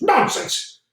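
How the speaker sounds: high, sharp exclamation; angry outburst. Acted. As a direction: snap it at him in disbelief. General rejects excuse.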